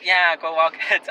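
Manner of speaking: reluctant, dragged-out reply, slow; unenthusiastic. Retold or imitated. Awkward teen answering the lady's cheer, least enthusiastic. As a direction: force out a half-hearted cheer. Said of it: "the least enthusiastic thing".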